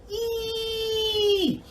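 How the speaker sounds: very high, stretched mouse squeak; squeaky mouse sound. Shown as a demonstration. Teacher leading face yoga, high mouse squeak. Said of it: "whiskers on your mouth face".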